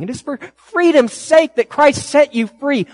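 high, loud preaching appeal, slowing on the question; rising pulpit plea; fervent preaching. Acted. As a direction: lift it up and plead with them.

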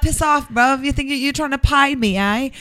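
put-on jokey Essex accent answering the driver; mock Essex accent. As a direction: give it a cheeky Essex telling-off. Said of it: "I was like".

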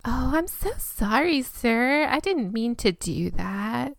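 put-on light Valley Girl apology; sweet, apologetic Valley Girl. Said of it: "sounded a little Valley Girl".

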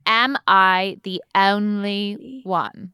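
Essex accent, said slowly and carefully; slow Essex accent practice. Shown as a demonstration. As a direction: drill it in Essex, stretching the vowels. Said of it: "I'm supposed to do an O A?".

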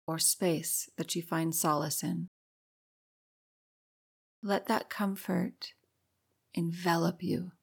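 hushed meditation guide leading breathing; guided meditation. Acted. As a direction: ease the listener into calm, gently.

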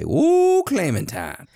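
soaring, loud falsetto swoop, then dropping; hammed-up high, loud cry of passion; playful exclamation. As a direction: whoop it up high, then land on the name. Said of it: "I want you to say".

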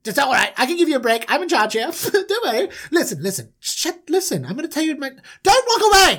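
high, excitable, pushy manager; bossy little manager. Acted. Bossy manager calls his unwilling brothers to a meeting, high and insistent. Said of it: "he's like".

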